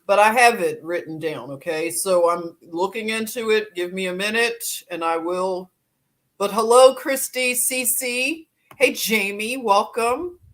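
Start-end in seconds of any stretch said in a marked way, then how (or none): none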